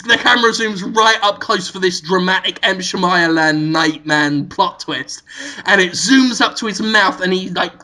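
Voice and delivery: normal talking voice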